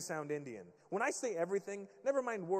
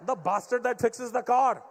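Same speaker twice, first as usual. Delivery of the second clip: louder, slow, exasperated Indian dad; slow loud Indian-dad exasperation; 4 semitones higher, 8 dB louder than usual. Retold or imitated. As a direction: spell it out to the idiot son, slowly.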